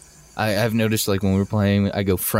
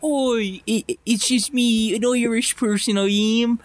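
high-pitched caricature Irish voice; Irish accent; 11 semitones higher than usual. Shown as a demonstration. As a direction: ham up a badly faked Irish accent, pitched way up. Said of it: "Let's hear an Irish accent".

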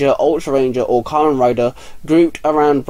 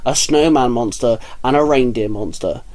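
plain reading voice

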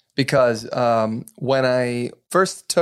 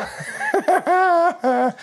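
high cackling laugh at his own pun; corny dad laugh. Real, not acted. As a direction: laugh hard at your own dad joke. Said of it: "now you're a dad joker".

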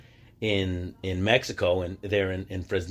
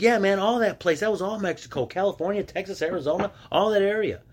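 high-pitched mimicry of a schoolmate; lightly acted classmate, much higher voice; imitated classmate; 9 semitones higher than usual. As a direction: imitate a kid in the know, voice up high. Acting out classmates explaining old Mexico.

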